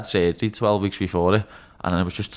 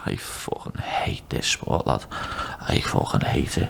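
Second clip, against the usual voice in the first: whisper; 9 semitones lower, 4 dB quieter, much slower than usual. Retold or imitated. Confessing to Fishy in lift.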